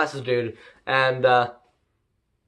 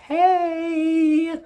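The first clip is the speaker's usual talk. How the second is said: mock fabulous villain. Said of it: "Kohaku's like".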